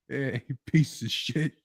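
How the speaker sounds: slow New York tough-guy sneer; Queens accent. Pretend. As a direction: drag out the insult, Queens style. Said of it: "a Queens accent".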